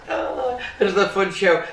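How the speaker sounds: ordinary delivery, nothing marked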